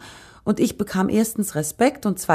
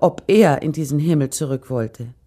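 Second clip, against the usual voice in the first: ordinary delivery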